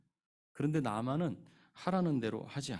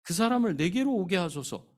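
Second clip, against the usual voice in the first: quoted line; 6 semitones higher, 7 dB louder than usual. Retold or imitated. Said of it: "그래서 팔 절에 이렇게 말합니다".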